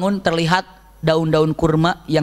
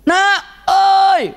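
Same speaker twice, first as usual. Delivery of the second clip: high, loud call; mother yelling for her child; shout; 13 semitones higher, 6 dB louder than usual. Retold or imitated. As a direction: bellow for the child. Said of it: "teriak-teriak udah kayak di hutan".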